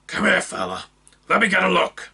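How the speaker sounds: high gruff orc coaxing. Acted. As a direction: coax him to hold still. Orc coaxes injured man.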